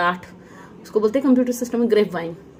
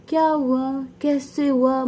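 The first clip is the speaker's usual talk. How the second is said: higher-pitched mock questioning; imitating nosy questioners; 6 semitones higher, 3 dB louder, slower than usual. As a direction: lightly imitate people who keep asking. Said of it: "तो लोग बार बार". (बार-बार पूछने वालों की हल्की नकल)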